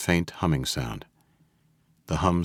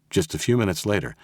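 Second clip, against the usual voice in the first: usual speaking voice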